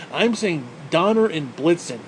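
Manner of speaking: slow "Donner and Blitzen", every syllable clear; Donner and Blitzen said slowly. Shown as a demonstration. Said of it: "That's how I'm saying it from now on".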